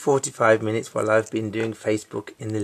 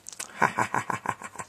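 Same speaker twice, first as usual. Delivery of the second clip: soft laugh; 4 dB quieter than usual. Real, not acted. Laughing at the dog.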